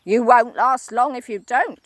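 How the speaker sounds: a hint of a nasty old man; Mister Twit, grisly. Husband threatening his wife, grinning. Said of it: "said Mister Twit".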